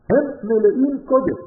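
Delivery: ordinary talk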